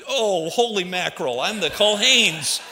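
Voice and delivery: loud, slow startled outburst; shocked exclamation. Retold or imitated. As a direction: blurt it out in sudden shock. Shocked at own reflection.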